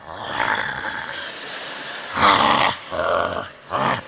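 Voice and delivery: low repeated orc grunts. Shown as a demonstration. Showing the orcs' limited expressions, low grunts. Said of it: "and then it was like".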